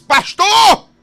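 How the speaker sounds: loud, high calling out "Pastor"; urgent call. Retold or imitated. Ezequiel calling out loudly to the pastor.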